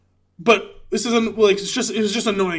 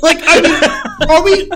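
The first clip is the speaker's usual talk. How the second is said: laughing; 9 semitones higher, 8 dB louder, slower than usual. Real, not acted. Laughing disbelief at movie criticism.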